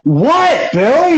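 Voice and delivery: exasperated retort. Retold or imitated. Storyteller replays answering Billy's complaint, exasperated. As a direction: answer a whining friend, fed up. Own exasperated reply in retold squabble.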